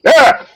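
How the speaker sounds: sudden high yelp of fright; a quick scared "ah"; startled yelp. Retold or imitated. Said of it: "and they're like".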